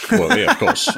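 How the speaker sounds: laughing it out, voice jumping high; sarcastic laughing agreement. Real, not acted. Sarcastic agreement about protecting children.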